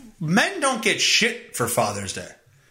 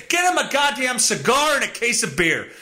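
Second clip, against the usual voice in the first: ordinary delivery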